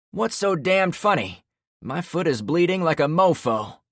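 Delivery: high, loud indignant yell; furious at being laughed at; angry shout. Acted. Trevor angry at chuckle.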